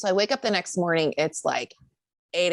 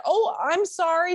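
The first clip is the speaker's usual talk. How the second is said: high, slow, meek sorry; high timid apology to a stern neighbour; sheepish apology; 12 semitones higher than usual. Retold or imitated. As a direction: apologise all innocent.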